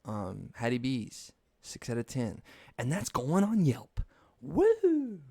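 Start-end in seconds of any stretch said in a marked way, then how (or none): 2.8-5.2 high, drawn-out announcement into a loud whoop; triumphant whoop. Acted. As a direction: crow it slowly, building to a high "Woo!". Announces bad review with a whoop.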